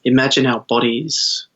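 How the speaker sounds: calm, unhurried meditation guide; guided meditation. Acted. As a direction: lead them gently into stillness.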